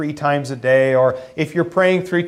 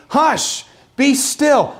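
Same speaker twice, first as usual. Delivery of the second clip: put-on high, firm shushing to a child; like to a toddler; 7 semitones higher, much slower than usual. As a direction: hush it like calming a fussy toddler. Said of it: "Almost like you would talk to a toddler".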